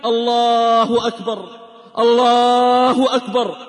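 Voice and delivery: slow, drawn-out muezzin's call; call to prayer. A narrator's reading. Muezzin calling the dawn prayer, slow drawn-out call. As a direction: stretch each phrase like the dawn adhan. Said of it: "وإذا بالمنادي ينادي".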